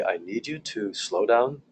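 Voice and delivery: slow, calm, low demo voice; slow them down by going slow and low yourself; measured de-escalating calm. Shown as a demonstration. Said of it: "I will go lower and slower".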